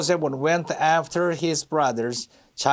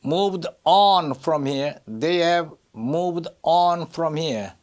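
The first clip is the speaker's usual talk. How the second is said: "on" drawn out and stressed; much slower than usual. Shown as a demonstration. Teacher models the verse for students, stress on "on". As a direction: slowly, lean on the word "on". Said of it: "on에 강세가 들어가요".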